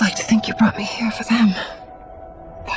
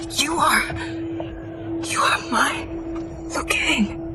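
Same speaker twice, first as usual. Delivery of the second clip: higher, halting, part-whispered realization; dawning recognition; 6 semitones higher, 5 dB quieter than usual. Acted. Woman realizing who stands before her, hushed and astonished. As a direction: let each phrase arrive after a stunned pause. Recognising a lost loved one.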